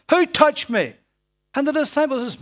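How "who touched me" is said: loud, high shout; shouted question. Acted. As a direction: call it out so the crowd stops. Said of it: "he probably shouted it".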